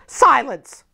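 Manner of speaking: a sharp, cold one-word order; stern command. A narrator's reading. Aunt silencing the begging child, sharp command. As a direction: cut the child off. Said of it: "This violence is almost repulsive".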